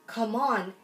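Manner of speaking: ordinary delivery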